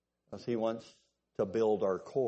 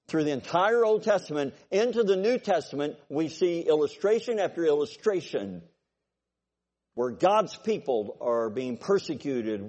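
rising preaching; 3 semitones higher, 4 dB louder than usual. Acted. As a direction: preach it up to the Amen. Preaching builds to full force.

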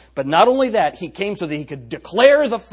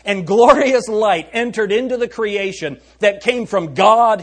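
booming biblical narration; 4 semitones higher, 7 dB louder, slower than usual. Acted. Preacher dramatises creation and Christ.

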